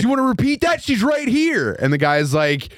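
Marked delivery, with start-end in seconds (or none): 0.0-1.7 high-pitched indignant challenge; hammed-up outrage; mock confrontation. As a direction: play an affronted husband squaring up, pitch raised. Retold father confronting his wife's insulter.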